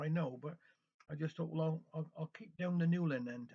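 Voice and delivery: ordinary delivery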